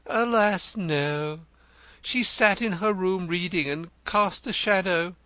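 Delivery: Denzil the poet. A narrator's reading. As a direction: play him florid and eager. Denzil answers Wimp.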